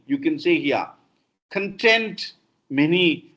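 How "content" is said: "content" said con-TENT, second syllable stressed; weak first syllable, punch TENT in "content". Shown as a demonstration. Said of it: "It should be content".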